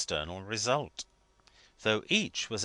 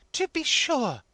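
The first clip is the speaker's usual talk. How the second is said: high, louder emphatic agreement; 6 dB louder, slower than usual. A narrator's reading. Wife agreeing emphatically, high-voiced. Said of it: "said the emphatic little wife".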